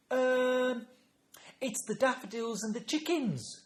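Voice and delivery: put-on high, loud toddler voice; imitated childish explanation; little granddaughter. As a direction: answer like a small child thinking it out. Granddaughter answers in the story.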